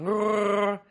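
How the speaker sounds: high gurgling Wookiee growl. Shown as a demonstration. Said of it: "Sorry, I'm not very good at the, at the Wookiee".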